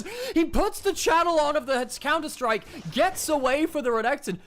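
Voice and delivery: loud, high play-by-play yell; excited shouting. Real, not acted. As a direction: shout the escape in disbelief. Caster yelling during escape fight.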